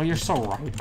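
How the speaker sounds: ordinary delivery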